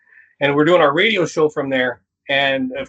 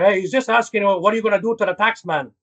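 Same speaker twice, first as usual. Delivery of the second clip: put-on Scarface accent; 4 semitones higher than usual. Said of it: "Manny Montoya character, Scarface thing".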